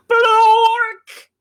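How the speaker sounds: high, loud drawn-out nonsense word; loud nonsense noise. Acted. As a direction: blurt out a silly sound. Book forces her to say nonsense.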